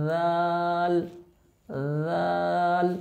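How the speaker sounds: জাল with tongue tip on the upper teeth; touch tongue tip to teeth for জাল; Arabic letter demo. Shown as a demonstration. Teacher showing students জাল. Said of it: "জিহ্বার আগা উপরের দুই দাঁতের আগার".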